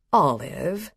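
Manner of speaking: a low, scolding tone. A narrator's reading. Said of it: "her voice lower now, almost chiding".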